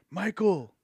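loud, high call-out; imitated shout from a distance; calling from upstairs. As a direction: holler a name down the stairs. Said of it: "at the top of the stairs".

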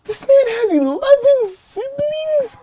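can't believe what you just heard; shocked disbelief. Real, not acted. Shocked at wrong answer.

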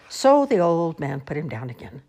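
ordinary delivery, nothing marked

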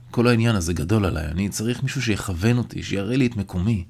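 usual speaking voice

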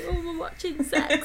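a child's mock-shocked laughing whisper-squeal; giggling kid. Kids giggling over naughty film.

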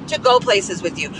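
usual speaking voice

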